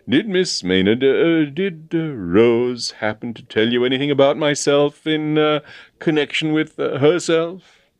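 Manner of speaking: hemming and hawing, pitch creeping up; awkward hesitation. A narrator's reading. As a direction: fumble towards a delicate question. Colonel awkwardly broaches the engagement.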